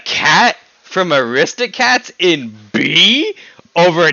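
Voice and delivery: high and loud, slowing in disbelief; can't believe what he just heard; incredulous shock. Real, not acted. Streamer reacting to guest ranking the cat over Ty Lee, loud disbelief.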